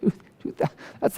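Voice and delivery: breathless whisper of disbelief; whispered shock at the price; stunned whisper. Retold or imitated. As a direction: drop to an airless whisper. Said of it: "And I'm like".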